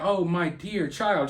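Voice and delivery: put-on soft, tender "my dear child"; sincere endearment. Commentator showing how the mother didn't say it, tenderly. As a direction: say it gently, as a fond mother would. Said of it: "she's not saying that".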